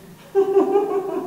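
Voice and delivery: a loud burst of laughter; cackling laugh. Acted. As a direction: crack up. Laughs at the court.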